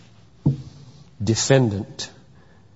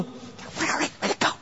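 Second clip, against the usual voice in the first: mock bewildered whisper; 11 semitones higher, 11 dB quieter than usual. Bewildered accuser looking around.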